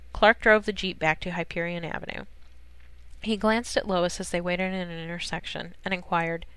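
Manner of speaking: ordinary delivery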